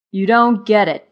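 loud, reproachful grasshopper; exasperated. A narrator's reading. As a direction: scold a friend who misses the point. Zimmer scolds carefree Marva.

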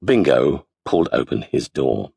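ordinary delivery

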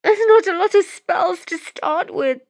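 very high, faltering woman's voice; stammering excuse. Acted. Accused woman answering the lord's accusation, stammering. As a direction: make a timid excuse high in the voice. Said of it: "she stammered".

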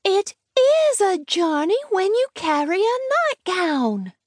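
put-on very high, insistent child's treble; high-pitched child calling her last word back. A narrator's reading. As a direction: pipe it out as a stubborn little girl. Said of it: "uttered in a high treble".

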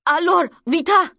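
high, loud urgent French scream. Acted. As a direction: scream at her to hurry. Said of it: "screaming at her in French to hurry".

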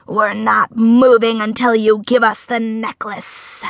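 sinister spider threat; creepy, forceful demand. A narrator's reading. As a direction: insist menacingly in a creature voice.

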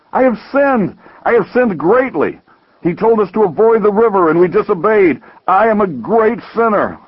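high, agitated self-reproach; worked-up, high-pitched confession; indignant outburst. Acted. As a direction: protest in rising, anguished indignation. Indignant brother confesses great sin.

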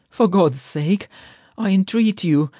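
high-pitched urgent pleading; breathless entreaty. A narrator's reading. Poisoned man begs the chemist, breathless. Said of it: "said Strizhin breathlessly".

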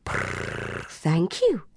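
soft breathy purr then thanks; purring cat. A narrator's reading. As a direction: purr it low like a contented cat. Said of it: "purred Ginger".

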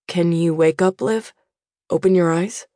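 lightly voiced father urging his daughter to open her eyes; father's voice. A narrator's reading. Father keeps asking daughter.

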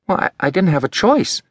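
put-on a boy explaining himself, a bit defensive; lightly voiced boy Elliot. A narrator's reading.

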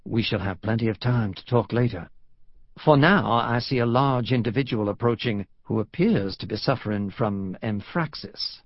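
put-on dry, refined Southern accent; courtly Southern gentleman; cultured Southern drawl. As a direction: speak discreetly to a friend in a Southern drawl. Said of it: "the cultured Southern drawl he remembered".

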